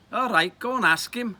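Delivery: put-on high, loud boyish prompting; bossy urging. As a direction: push your friend to go and ask. Friend bossing him, acted dialogue.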